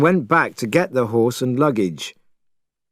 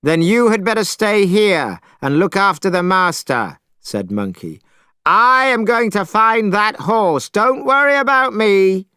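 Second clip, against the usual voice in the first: determined Monkey; 8 semitones higher than usual. A narrator's reading. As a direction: announce you're off for the horse. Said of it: "Monkey determined, raised voice".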